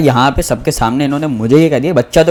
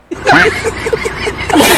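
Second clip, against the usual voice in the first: high burst of laughter; cracking up at the punchline; laughing. Real, not acted. Laughs after his punchline. (ठहाका मारकर हँसी)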